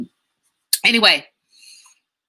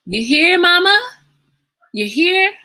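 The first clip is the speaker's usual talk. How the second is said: high, louder call; 12 semitones higher, 5 dB louder than usual. Real, not acted. Looking for mom among live viewers, calling out loudly. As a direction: call out to find her in the chat.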